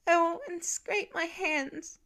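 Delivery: put-on high, slow child's whine; hurt boy telling his father; tearful whine. A narrator's reading. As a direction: whine it through tears. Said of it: "The tearful whine".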